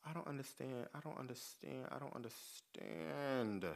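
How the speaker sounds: quiet, slowing disbelief; soft baffled repeat. Retold or imitated. Scene: to straight commenters, softly baffled. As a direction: murmur it over and over, lost. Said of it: "I'm just like".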